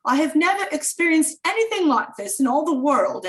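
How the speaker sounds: a hint of a British host gushing; lightly put-on British accent. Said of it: "he says in his great British accent".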